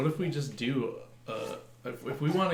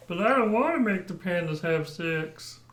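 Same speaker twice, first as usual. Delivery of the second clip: higher, louder Kermit voice, a poor imitation; bad Kermit impression; 6 semitones higher, 4 dB louder than usual. Friend's answer on the panda podcast idea, bad Kermit voice. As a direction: squeak it out like a failed Kermit. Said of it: "That's not a very good Kermit".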